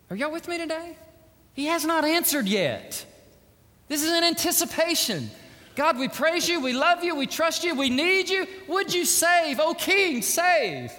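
high, loud prayer cry; full-force preaching. Acted. Preacher leading the church in a loud pleading prayer.

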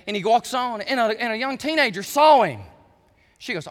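ordinary talk

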